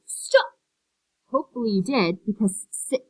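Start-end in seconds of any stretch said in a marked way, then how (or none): 0.1-0.5 short, loud model shout; demonstrated yell. Shown as a demonstration. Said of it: "Did anyone yell". 2.7-3.0 "six" broken open on its short ih; short I emphasized. Shown as a demonstration. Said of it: "that's a short I".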